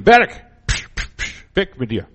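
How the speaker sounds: mock command with shooing noises; commanding the mountain. Preacher acting out a believer commanding the mountain, shooing. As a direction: shoo the mountain away. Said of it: "Wir befehlen".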